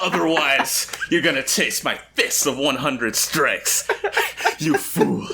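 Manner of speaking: high, loud robotic villain through laughter; hammed-up robot villain threat, laughing. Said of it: "he has, like, a robotic voice".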